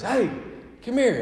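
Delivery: hey, come over here; calling out. Retold or imitated. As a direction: hail him from a distance. Large man calls pastor over.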